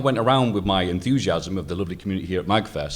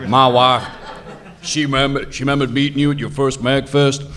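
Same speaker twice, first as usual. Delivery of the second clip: put-on laughing Ohio accent; 6 semitones higher, 5 dB louder than usual. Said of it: "I'll stop doing the accent".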